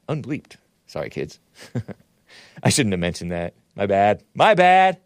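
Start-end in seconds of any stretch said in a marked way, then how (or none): 4.3-5.0 louder and higher. Real, not acted. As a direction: exclaim it loudly. Repeats apology, second louder.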